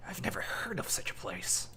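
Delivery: hushed, whispery musing; muttering. Acted. Sage checking his book for the place, muttering in a whisper. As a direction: whisper it to yourself, absorbed in the book. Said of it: "and he mutters".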